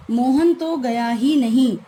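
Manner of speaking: तो emphasized in a slow, loud delivery; stress on तो. Shown as a demonstration. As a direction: hit तो hard, slow and loud. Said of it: "तो शब्द पर हमने अतिरिक्त बल दिया है".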